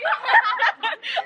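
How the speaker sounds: high giggle at her own try; embarrassed laugh. Real, not acted. Vlogger laughs after trying the chant, high giggle. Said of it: "I tried, I tried".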